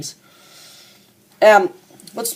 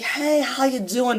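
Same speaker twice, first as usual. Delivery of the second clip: fake cheerful greeting; 5 semitones higher, 6 dB quieter, slower than usual. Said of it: "I'm gonna be all smiles".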